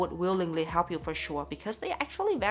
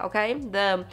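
ordinary talk

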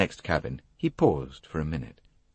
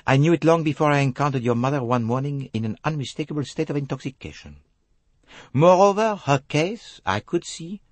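put-on French-accented detective expounding, higher and insistent; Hercule Poirot; 7 semitones higher than usual. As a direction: lay out his deductions firmly in a French accent.

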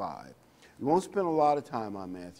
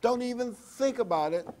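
Christ's warning; 7 semitones higher than usual. Retold or imitated. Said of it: "Christ says".

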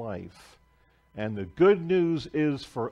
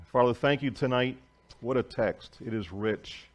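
closing prayer; 2 semitones lower than usual. Acted. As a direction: pray gently over the text. Pastor leads closing prayer.

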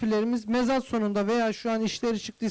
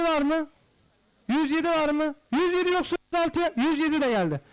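rhythmic auction calling; auctioneer; 5 semitones higher than usual. Real, not acted. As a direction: call the bids like an auctioneer. Livestock auction bidding calls.

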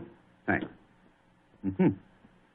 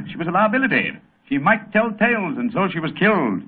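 rising indignation; 9 semitones higher than usual. Acted. Holmes exposing Moriarty's murdered accomplices, scornful rising anger. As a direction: build the accusation to a sneer.

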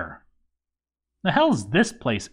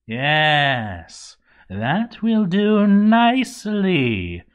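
villainous purr; 4 semitones higher, 3 dB louder, much slower than usual. Acted. Villainous satisfaction at brewing.

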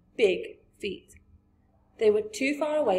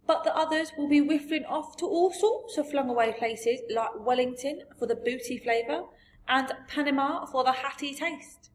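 friendly giant explaining in a higher voice; lively storybook giant, relishing odd words; BFG; 6 semitones higher, 4 dB louder than usual. A narrator's reading.

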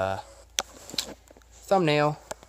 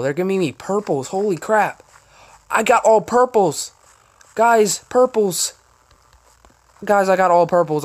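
excited shout; 7 semitones higher, 9 dB louder than usual. Real, not acted. Winning votes in build game.

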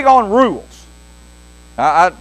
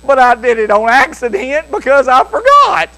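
mock whine climbing higher; crying child; 4 semitones higher than usual. Crying boy explaining his accident, whiny sobbing imitation. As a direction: imitate a tearful boy making excuses. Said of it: "he started crying".

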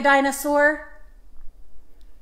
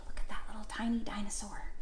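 soft, quick near-whisper; hushed for a tiny dinosaur; 12 dB quieter, faster than usual. Acted. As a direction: whisper it small. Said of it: "dinosaur tiny".

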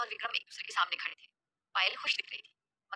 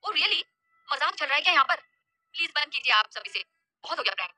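loud angry outburst; 3 semitones higher, 7 dB louder than usual. A narrator's reading. Son shouts at family over unwanted engagement, loud and angry. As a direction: snap at them, fed up with the prank. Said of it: "Vikram ने तेज आवाज में कहा".

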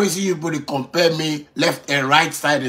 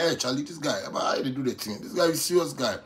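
softer, hushed mock awe; awed quiet voice; 8 dB quieter than usual. People in the industry whispering about him, in hushed awe. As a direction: murmur it like someone impressed and a bit scared. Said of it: "you go to sound like".

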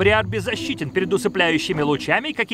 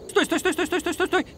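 frantic pleading; 7 semitones higher, 4 dB quieter than usual. Acted. Rex scrambles, begging for time.

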